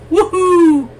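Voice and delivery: very high loud woohoo; excited whoop. Real, not acted. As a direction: cheer the breakout. Stock crosses two hundred.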